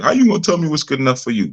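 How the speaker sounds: lightly put-on indignant comeback; mock challenge. Imagined woman talking back to the speaker, mock challenge.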